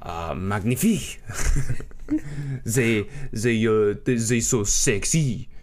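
drawn-out, seductive mock-French; French accent. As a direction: savour it in a hammed-up French accent. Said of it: "sexy about the French accent".

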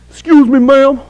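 put-on a weak try at a New York accent; lightly voiced New York guy; New York accent attempt. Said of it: "I don't know how to do a New York accent".

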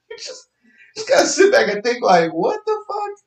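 high whiny mock sob; mock crying. Vlogger fake crying over wildfire guilt, high whine. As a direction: fake cry the disbelief.

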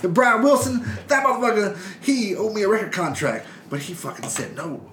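high hammed-up Manson voice; Charlie Manson impression. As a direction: boast like a cackling jailhouse Manson. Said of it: "That was a perfect impression".

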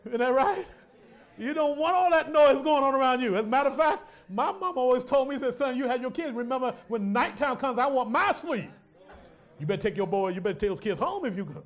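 loud and high, driving on; loud preaching. Real, not acted. Preacher to congregation, loud with his mom's warning. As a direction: belt it to the crowd.

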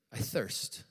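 breathy whisper, no voice; the dying man's whisper. Acted. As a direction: let it fade into a parched whisper. Jesus's words on the cross.

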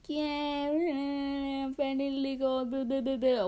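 high, drawn-out mock cheer. Teen imitates birthday well-wishers, mock cheering. Mimicking repetitive birthday congratulations.

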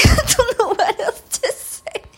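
giggling high and loud through the words; laughing. Real, not acted. Signing off to listeners, giggling high and loud. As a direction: laugh it out, can't stop.

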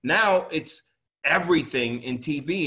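normal talking voice

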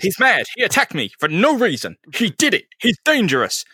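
high, hammed-up victim; mock-hysterical accusing. Fallen captain accuses Peter to his daughter, mock hysterical. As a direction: pitch it way up, playing the wronged man.

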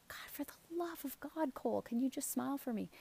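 breathy, exasperated begging; exasperated plea. Retold or imitated. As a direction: plead with a sigh of frustration. Mother begging son to smile.

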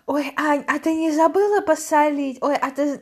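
выше и громче, поучающая бабушка; пропеть как заботливая бабушка; голос бабушки. Retold or imitated. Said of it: "Поскольку". (бабушка поучает внучку, высоким громким голосом)

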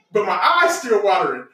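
normal talking voice

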